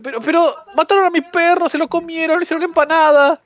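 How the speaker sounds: high, mocking goddess voice; breezy, uncaring goddess. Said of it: "dice la diosa ahí".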